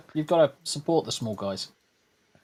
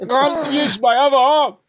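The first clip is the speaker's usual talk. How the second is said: higher, louder mock voice; 10 semitones higher, 5 dB louder than usual. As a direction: put on a silly voice. Voicing Greg mockingly.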